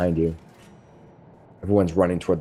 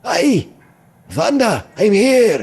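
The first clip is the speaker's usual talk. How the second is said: high call from far away; muffled distant call; 12 semitones higher, 3 dB louder, much slower than usual. Acted. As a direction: shout from deep inside the keep. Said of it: "a muffled voice coming from deeper".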